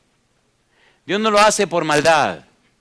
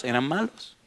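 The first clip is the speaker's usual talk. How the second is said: "Eran malos" said much softer, almost under the breath; quiet answer; 8 dB quieter than usual. Shown as a demonstration. Preacher answers his own question to the church, much softer.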